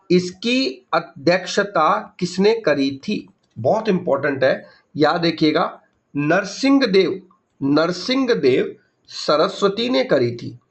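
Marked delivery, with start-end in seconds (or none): 0.1-3.3 very slow, spaced-out question; slow dictation. Shown as a demonstration. Teacher dictating an exam question to students, very slowly. 6.1-10.6 slow, deliberate answer; slow dictation. Shown as a demonstration. Teacher giving students the answer to remember, slowly.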